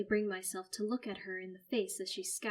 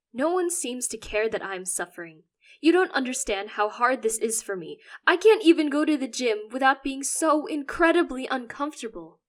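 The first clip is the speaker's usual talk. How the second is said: loud complaining; 5 semitones higher, 13 dB louder than usual. Acted. Teen complains of suffering.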